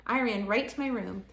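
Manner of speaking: usual speaking voice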